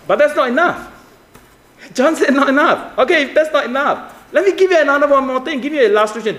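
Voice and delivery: high-pitched, driving sermon delivery; impassioned preaching. Real, not acted. Preacher challenging listeners who know Moses' law, impassioned preaching.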